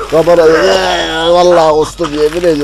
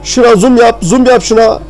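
a lightly hammed-up TV reporter voice, higher; mock news reporter; 5 semitones higher than usual. Reporting live to the viewers in a mock reporter voice. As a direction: play a field reporter covering chaos, tongue in cheek.

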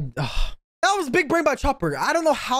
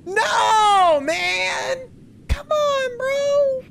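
high, wailing disbelief; exasperated protest; 13 semitones higher, much slower than usual. Real, not acted. Viewer learning they're still alive, exasperated wail.

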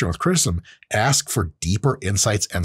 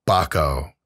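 low bucko; 8 semitones lower than usual. Shown as a demonstration. Demonstrating the key word of a Peterson impression, said low. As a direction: land "Bucko." low and deliberate. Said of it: "Bucko is critical to any Jordan Peterson impression".

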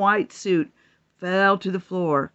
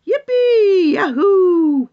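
high, louder whoops; gleeful cheering; 11 semitones higher, 6 dB louder, slower than usual. Acted. Animals partying at the barn, gleeful shouts.

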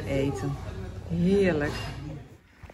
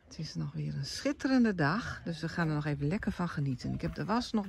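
whispering; 5 semitones lower, 5 dB quieter, faster than usual. Real, not acted. Quiet aside while filming.

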